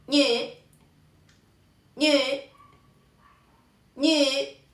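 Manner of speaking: ñe held long, high, with rounded vowel; Mandarin syllable demo. Shown as a demonstration. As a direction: model ñe like nü, stretched out. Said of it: "Y se dice". (ñe alargado y agudo, vocal redondeada)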